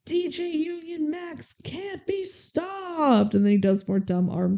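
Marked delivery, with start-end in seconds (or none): 0.1-3.3 high, slow, hammed-up hype-man call; hyped announcer. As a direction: stretch it out like a cheesy DJ hyping himself. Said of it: "he goes".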